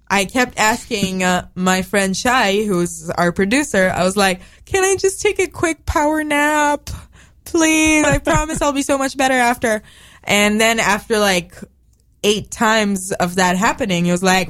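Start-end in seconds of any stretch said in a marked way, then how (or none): 4.6-9.8 pleading. Retold or imitated. Pleading to producer for nap.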